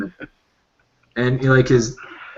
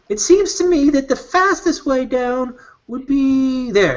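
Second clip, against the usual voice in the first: much higher, slow, earnest guide voice; deadpan Birdie; 12 semitones higher than usual. Acted. Birdie innocently suggests jumping off, slow and much higher. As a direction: point it out slowly and innocently. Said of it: "He says".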